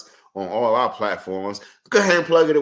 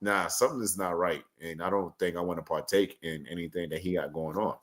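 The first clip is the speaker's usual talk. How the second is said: imagined wary reaction, low and soft; wary refusal; 6 semitones lower, 9 dB quieter than usual. Retold or imitated. Imagined onlookers deciding to stay out of it, low wary refusal. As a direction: softly voice someone backing away, pitched down. Voicing what wary people think.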